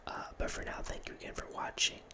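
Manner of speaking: normal talking voice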